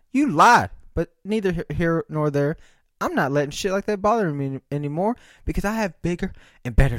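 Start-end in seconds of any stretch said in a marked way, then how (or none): 0.1-0.7 louder, pointed accusation; accusing. Real, not acted. To the lying delivery driver, loud accusation. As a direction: call the liar out.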